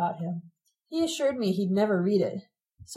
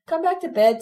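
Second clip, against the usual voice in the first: mother's shout, much higher and louder; 9 semitones higher, 5 dB louder than usual. A narrator's reading. Mother shouting to her husband from bed. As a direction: call out from the bedroom.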